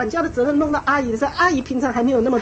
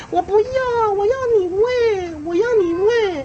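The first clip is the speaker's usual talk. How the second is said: put-on high, drawn-out toddler whine; whiny child; 8 semitones higher, slower than usual. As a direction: imitate a child insisting in a singsong wail. Acting out child refusing to eat. (模仿小孩高声耍赖)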